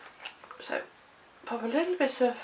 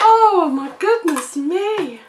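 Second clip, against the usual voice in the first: drawn-out, higher and louder exclamation; dismayed surprise; 8 semitones higher, 13 dB louder, slower than usual. Real, not acted. Crafter finds she cut it upside down, loud slow dismay. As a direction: cry out slowly at your own mistake. Oh, I've done it upside down now.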